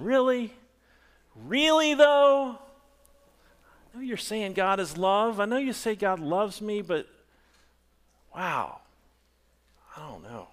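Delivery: a touch of a questioning doubter; incredulous. Retold or imitated. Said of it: "we question".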